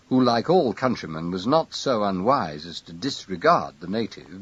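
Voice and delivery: ordinary delivery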